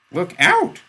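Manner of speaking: high, loud alarmed cry; urgent warning shout. A narrator's reading.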